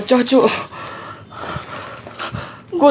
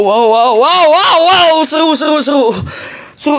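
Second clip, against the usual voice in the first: ordinary delivery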